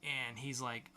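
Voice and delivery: normal talking voice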